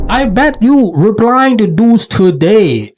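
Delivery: high, loud playful ribbing; calling her bluff with a singsong jab; teasing disbelief. Real, not acted. Commentator teasing the poster about dating apps, high mocking singsong. Teasing the woman who says she's done dating.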